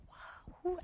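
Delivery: whispered, breathless wonder; hushed surprise. Retold or imitated. Colleague whispering her surprise at the helpful stranger. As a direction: murmur it in amazement.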